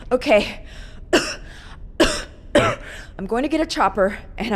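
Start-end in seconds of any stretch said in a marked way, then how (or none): 0.7-3.1 harsh unvoiced coughs; sick coughing. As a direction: cough through the sickness. Infected Leon winding down.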